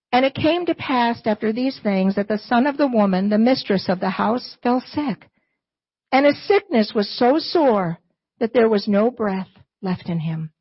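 usual speaking voice

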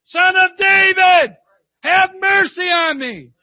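loud, high, drawn-out cry for mercy; yelled out. Retold or imitated. Blind man calling Jesus through the crowd, yelled out. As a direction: call out desperately over a crowd. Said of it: "yelled out".

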